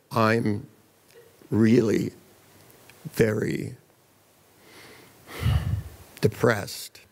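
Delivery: low, dragging words with long pauses; painfully slow depressive. Retold or imitated. Depressed client introducing herself to the therapist, painfully slow. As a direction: drag it out word by word, low and heavy. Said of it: "She spoke so slowly".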